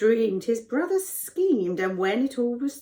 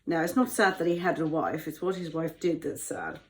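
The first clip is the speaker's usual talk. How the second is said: ordinary delivery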